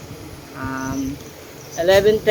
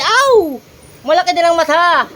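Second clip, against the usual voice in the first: excited; 11 semitones higher, 6 dB louder than usual. Real, not acted. Excited exclamation at the boy.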